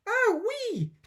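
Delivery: very high, loud shriek; grandma jolted by the medicine, shrieking; shrieking yell. Acted. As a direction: shoot up with a squeal. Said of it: "Grandma yelled".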